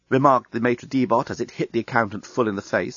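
plain reading voice

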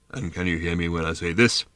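low, sepulchral bird voice; sepulchrally deep voice. A narrator's reading. Bird testing a girl's hearing range, sepulchrally deep. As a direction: drop deep, as if from a tomb. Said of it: "in a sepulchrally deep voice".

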